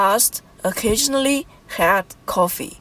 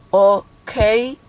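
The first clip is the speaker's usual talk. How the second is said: syllable by syllable. Shown as a demonstration. Teacher counting vowels for learners, "occasionally" syllable by syllable. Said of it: "five vowels, so five syllables".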